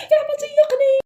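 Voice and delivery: high, crying plea; tearful plea. Acted. Crying child pleading with the neighbour to believe him. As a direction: beg through tears. Kid pleading through tears.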